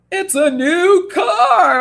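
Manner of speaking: high, slow, hammed-up announcer; mock the big prize reveal; game show announcer. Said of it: "when they were like".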